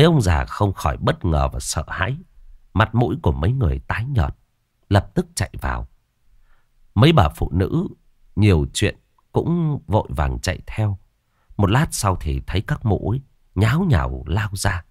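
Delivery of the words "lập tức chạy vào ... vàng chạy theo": plain reading voice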